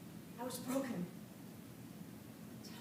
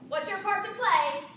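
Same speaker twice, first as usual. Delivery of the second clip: loud, firm demanding question; stern drill sergeant question; 3 semitones higher, 7 dB louder than usual. Retold or imitated. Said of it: "she spoke like a drill sergeant".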